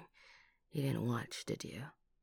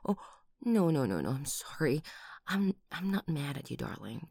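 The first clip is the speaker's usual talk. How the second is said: ordinary talk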